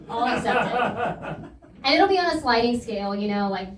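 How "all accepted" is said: slow, stretched All accepted with a laugh; drawn-out repeat. Shown as a demonstration. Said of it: "repeated drawn out with laugh".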